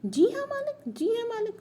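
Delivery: squeaky-high angry mockery; angry mimicking. A narrator's reading. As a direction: repeat it jeeringly, pitch leaping up. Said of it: "गुस्से से तुतलाने लगता है". (गुस्से में ऊंची आवाज़ में नकल)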